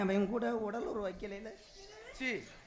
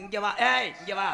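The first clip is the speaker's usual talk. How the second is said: loud, higher-pitched order to come here; sharp summons; 3 semitones higher, 10 dB louder than usual. Acted. Man ordering a suspect over to check, sharp shout. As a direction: call them over with a sudden shout.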